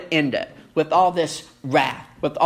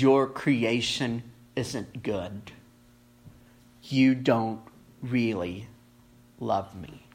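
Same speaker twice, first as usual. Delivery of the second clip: quiet wounded complaint; 7 semitones lower, 5 dB quieter, slower than usual. Acted. Voicing the heart's complaint.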